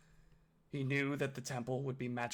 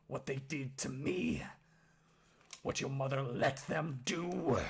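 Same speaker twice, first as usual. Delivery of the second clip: gruff, accusing growl, building louder; bitter orc father; 5 dB louder than usual. Acted. Orc father in Oski's face, bitter gruff growl. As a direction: growl the accusation, rising in volume.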